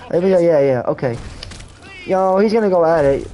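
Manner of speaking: ordinary talk, nothing marked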